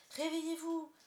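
high, loud, thrilled shouting; urgent, jubilant call; excited wake-up call. A narrator's reading. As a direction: shout the sleepers awake with big news. Wendy rouses her sleeping brothers.

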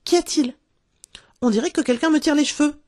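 high-pitched yelp of alarm; sudden pained surprise, pitched high; startled cry. A narrator's reading. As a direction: cry out as if someone just yanked your hair. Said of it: "elle poussa un cri".

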